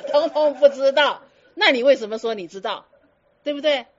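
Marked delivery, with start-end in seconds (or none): 0.1-1.2 louder and slow, teasing them; slow, louder teasing summary. Real, not acted. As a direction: spell it out slowly, ribbing the class. Teasing the audience.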